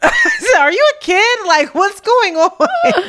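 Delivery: laughing stunned squeal; shocked disbelief. Retold or imitated. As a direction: react like you can't believe your ears. Shocked that a kid sounds so grown.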